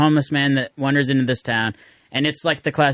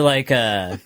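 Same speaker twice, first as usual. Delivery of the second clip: ordinary talk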